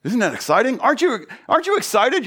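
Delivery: normal talking voice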